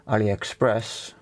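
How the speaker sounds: normal talking voice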